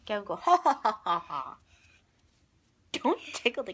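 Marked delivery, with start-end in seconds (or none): 0.3-1.6 louder ha ha laugh. Real, not acted. Parent laughing with the baby, louder ha ha.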